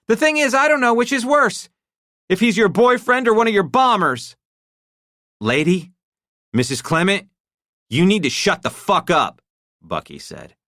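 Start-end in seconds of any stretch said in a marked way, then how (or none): 0.1-4.3 much higher, louder outburst; voice rising. A narrator's reading. Wife lashing out at her husband, voice rising high. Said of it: "Her voice rose". 5.4-9.4 higher, louder threat; menacing henchman. A narrator's reading. Henchman threatening the wife, harsh and loud. Bucky voiced.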